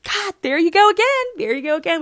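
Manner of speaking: high, quick mock exclamation; retold swooning exasperation. Her retold reaction to cute quote.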